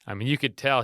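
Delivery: ordinary talk, nothing marked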